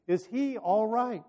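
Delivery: mildly acted royal demand. A narrator's reading. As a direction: press urgently for an answer. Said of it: "the king demanded".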